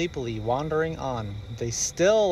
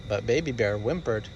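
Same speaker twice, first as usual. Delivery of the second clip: usual speaking voice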